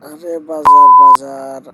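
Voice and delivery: loud shout across the room; calling after someone. Acted. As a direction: yell to stop her leaving. Husband calls after leaving wife.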